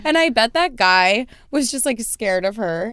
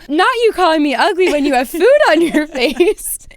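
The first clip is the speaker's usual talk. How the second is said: higher, louder mocking comeback breaking into laughter; laughing retort; 5 semitones higher, 5 dB louder than usual. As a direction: laugh right in his face.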